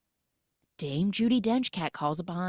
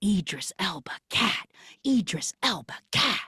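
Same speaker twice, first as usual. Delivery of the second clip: chorus of cats. Retold or imitated. Voicing the cats exclaiming the name.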